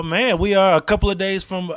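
ordinary talk

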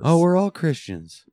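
high, loud mocking Southern accent. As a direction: parody a churchgoer in a Southern accent. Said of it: "talking in Southern accents".

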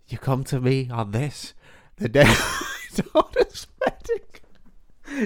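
badly faked Mafia don, cracking into laughter; the Godfather. Podcaster trying his best impression for his co-host, badly faked don breaking up. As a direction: attempt a mob boss and lose it laughing. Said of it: "This is my best Godfather impression".

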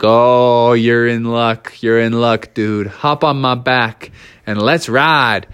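a chill big moose offering a ride; laid-back moose. Acted. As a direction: moose to frog, low and relaxed.

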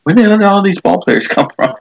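high, fast, disbelieving snap; mock outburst. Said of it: "helps the brother-in-law snap and say".

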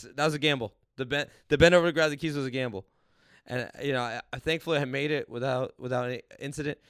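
usual speaking voice

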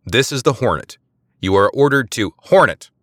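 high, loud military command; commanding bark. A narrator's reading. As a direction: bark orders at the ship. Eliza barking at the Hornet.

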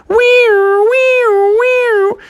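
very high, loud wailing siren noise; imitated police siren; siren imitation. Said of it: "they heard the sirens".

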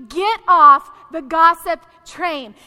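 slow, loud, word-by-word command; emphatic command. Real, not acted. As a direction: hammer each word out slowly. Commands congregation, repeating her point.

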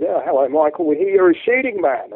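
hearty imitation of an Australian bloke; Australian accent. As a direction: put on a loud Australian accent. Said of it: "kill me for this Australian accent".

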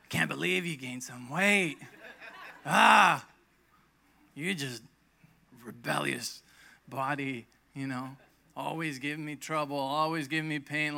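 a hint of a fed-up scold; mock scolding. Person scolding own body, mildly mock angry. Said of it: "not very nice way".

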